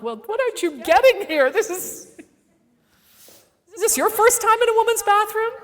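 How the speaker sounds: high, fast, incredulous whine; mock exasperation at the stranger; high exasperated disbelief. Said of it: "And I'm like".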